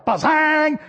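sudden loud, high burst; jump-scare shout. Acted. Family member leaping from a dark closet to scare a passer-by, startling shout. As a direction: shout it out to make someone jump. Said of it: "when you walk by".

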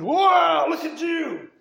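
loud, high exclamation of disgust; mock shock. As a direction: recoil loudly from the mess, high and loud. Teenagers recoil from messy child.

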